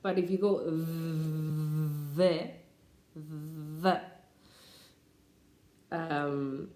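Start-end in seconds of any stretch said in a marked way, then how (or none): 0.6-4.0 "the" with a long held th; long "the" demo; long th sound. Shown as a demonstration. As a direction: stretch the th in "the" before the vowel. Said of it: "then it's longer".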